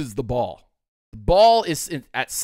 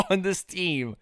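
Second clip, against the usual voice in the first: words squeezed out through real laughter; trying to finish the line while cracking up; laughing through words; 2 semitones higher, 4 dB quieter than usual. Real, not acted. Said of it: "I'm laughing too much about it".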